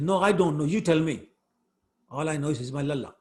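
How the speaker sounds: mock-indignant, loud comeback; retold angry yelling. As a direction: act out a mother snapping back. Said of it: "So she started yelling at him".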